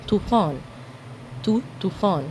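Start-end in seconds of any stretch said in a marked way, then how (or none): none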